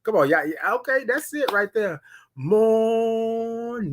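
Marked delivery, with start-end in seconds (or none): 2.4-3.9 slow, stretched, high morning; drawn-out morning. Shown as a demonstration. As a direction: hold the word morning long. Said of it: "You gotta say it like that".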